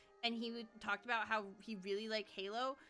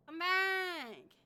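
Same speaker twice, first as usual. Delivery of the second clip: calling out; 6 semitones higher, 6 dB louder than usual. Acted. Calling character.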